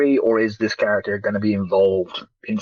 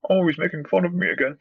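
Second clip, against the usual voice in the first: higher-pitched mock whine; whiny George Lucas; 6 semitones higher than usual. Podcaster mocks director complaining of being teased, whiny voice. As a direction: lightly mimic a sulky complainer. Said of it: "George Lucas wrote that shit".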